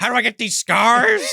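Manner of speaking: high, loud villain's growing question; Joker. Acted. Co-host riffing on the surgery scars, loud Joker impression. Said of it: "Joker surgeon or the brother".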